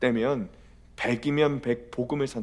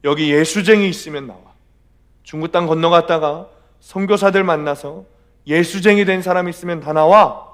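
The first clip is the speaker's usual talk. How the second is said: loud, raised-pitch guard's order; angry retold yell, hard and forceful; guard shouting; 4 semitones higher, 10 dB louder than usual. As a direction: shout it out like a camp guard rounding up prisoners. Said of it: "교도관 하나가 나오더니 막 소리를 지르는".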